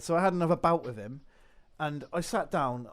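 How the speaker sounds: ordinary talk, nothing marked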